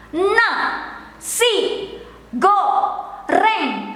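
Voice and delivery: "Na, si, go, reng" spoken syllable by syllable with pauses; staccato syllables. Shown as a demonstration. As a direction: give each syllable of "Na, si, go, reng" its own beat. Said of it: "Syllable by syllable".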